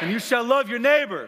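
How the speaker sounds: high, loud proclaiming shout; shouted call to the congregation; with chutzpah. Real, not acted. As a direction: call it out with guts to the crowd. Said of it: "with some guts".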